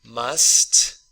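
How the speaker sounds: usual speaking voice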